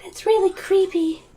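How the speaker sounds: put-on very high, small girl voice; Zoe. A narrator's reading. Zoe looking at the gargoyle, creeped-out squeak. Zoe's voice in the story.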